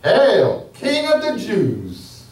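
mock him with a fake salute; mocking taunt. A narrator's reading. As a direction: soldiers taunting Jesus in mock homage. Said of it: "they taunted him".

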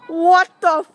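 high, loud panicked shriek; terrified scream. Pretend. Vlogger staging a scare outside, terrified scream. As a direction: shout it out in rising terror.

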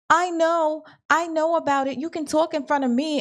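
high-pitched imitation of a know-it-all woman; mock butting in. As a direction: chime in high, as if in on the secret.

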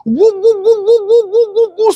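high siren whoops; siren imitation. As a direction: mimic a patrol siren, high and sharp.

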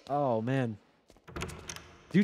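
normal talking voice

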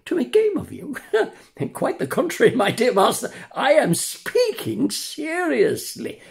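high, teasing, mock-earnest; cheeky puppet. Pinocchio teases his master, high and cheeky. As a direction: play innocent with a grin.

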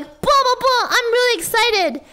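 high-pitched excited chatter; really excited. Shown as a demonstration. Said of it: "like, talking, I'm like".

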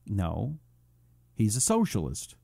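usual speaking voice

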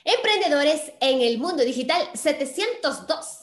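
podcast title call; show title announcement. Acted. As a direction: announce the episode with punch. Announces show title and episode number.

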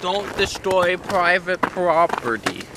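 normal talking voice

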